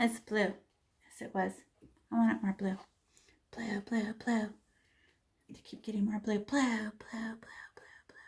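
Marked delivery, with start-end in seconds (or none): 3.5-8.2 soft chant fading into whisper. Shown as a demonstration. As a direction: murmur blue until it's breath. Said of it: "demonstrating strokes".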